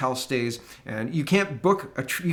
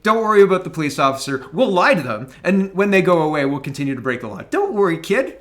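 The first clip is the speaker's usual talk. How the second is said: louder, hammed-up breezy reassurance; mock reassurance; 3 semitones higher, 6 dB louder than usual. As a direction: sell the lawbreaking like it's no big deal, higher and louder. Mock reassurance of his kid.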